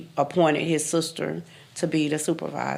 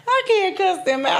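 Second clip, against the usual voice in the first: laughing, high. Retold or imitated. Her naive reply, retold laughing.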